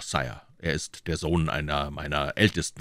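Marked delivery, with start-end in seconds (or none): none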